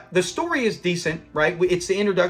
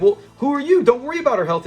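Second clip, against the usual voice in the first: higher, louder retold retort; mock indignant; 7 semitones higher, 4 dB louder, faster than usual. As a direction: act out an indignant comeback. Acted argument in a retold episode.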